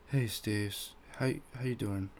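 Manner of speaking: soft, halting, sheepish hello; awkwardly embarrassed. Retold or imitated. Coworker greeting the man he insulted, awkwardly embarrassed. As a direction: get the greeting out quietly, stumbling a little. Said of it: "he's awkwardly embarrassed or whatever".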